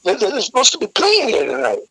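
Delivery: high mocking security guard voice; security guy. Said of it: "And he says".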